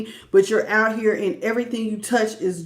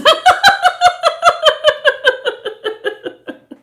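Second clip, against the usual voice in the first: loud laugh; 6 dB louder than usual. Real, not acted. Viewer's name Tie-dye amuses her.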